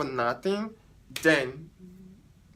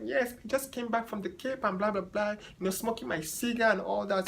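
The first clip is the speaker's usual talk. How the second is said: higher mock bragging voice; lightly hammed-up rich client showing off; 7 semitones higher than usual. Stylist mocking the client's bragging, higher and airy. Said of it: "She's like".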